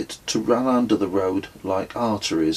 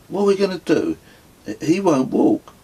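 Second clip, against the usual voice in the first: distressed; 10 semitones higher, 3 dB louder, faster than usual. Acted. Narrator turns to Claudio, distressed.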